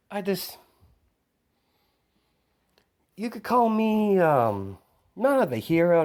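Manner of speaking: high, loud, coy antihero voice; high character voice. Acted. Izuku answering the boy who asks if he is a hero, high and coy. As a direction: tease the kid, high and playful.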